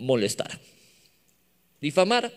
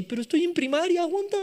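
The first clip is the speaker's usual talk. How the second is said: put-on very high-pitched protesting child; overwhelmed schoolkid; 12 semitones higher, faster than usual. Pupil to maths teacher, high overwhelmed protest. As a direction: squeal a pupil's mock plea for mercy. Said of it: "y tú así".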